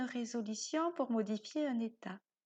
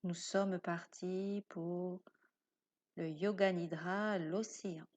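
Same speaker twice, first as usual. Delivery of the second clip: guided meditation. Acted. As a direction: lull the listener towards sleep. Guide une séance de yoga nidra. (voix de méditation guidée, lente et douce)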